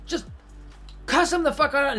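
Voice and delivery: ordinary talk, nothing marked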